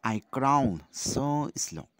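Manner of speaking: loud, slow verse line; drawn-out classroom poem reading; poem recitation. Shown as a demonstration. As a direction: recite it slowly and loudly to the class.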